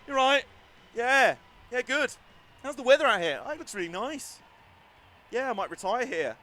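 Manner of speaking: high, loud chirpy voice for the stopped drivers; chatty parked drivers. Retold or imitated. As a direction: play the drivers chatting casually after parking. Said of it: "Just like".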